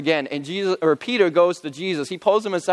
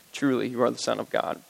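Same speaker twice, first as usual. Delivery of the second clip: softer, lower echo of the confession; hushed repeat; 6 semitones lower, 6 dB quieter than usual. Acted. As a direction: let it fall to an awed hush.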